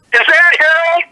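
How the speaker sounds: high-pitched, retold fearful question; imitating frightened friend. Crying friend points toward the dining room, imitated fright. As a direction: mimic an upset friend asking about the doll. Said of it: "just crying her little eyes out".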